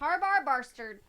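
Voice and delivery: loud, slow, laughing; laughing shout. Real, not acted. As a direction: shout it while cracking up. Laughing shout of silly name.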